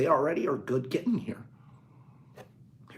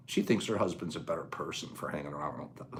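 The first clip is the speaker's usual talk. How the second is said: a soft, breathy half-whisper, much lower; retold whisper; 7 semitones lower, 8 dB quieter than usual. Telling the group what the wife confided: a quiet retold whisper. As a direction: hush it like passing on a secret. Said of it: "she whispered to me".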